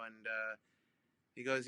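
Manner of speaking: usual speaking voice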